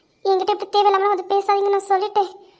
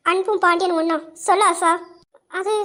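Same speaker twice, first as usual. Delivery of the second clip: ordinary delivery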